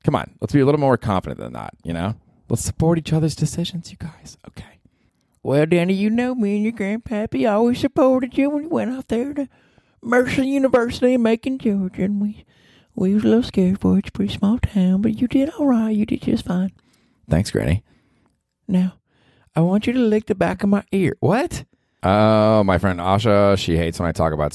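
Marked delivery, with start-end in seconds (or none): 2.5-4.8 higher voice fading to a whisper; soothing plea trailing off to a whisper; mock gentle whisper; sweet whisper. Far then whispered. 5.4-16.7 put-on high, thin old southern granny; proud grandma reassuring her grandson. 18.7-21.6 granny voice. Acted. Granny voice, far, high.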